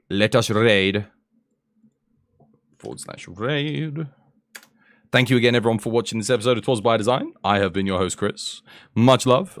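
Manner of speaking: smooth cabin announcement voice; flight attendant. Acted. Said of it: "I sound like a flight attendant".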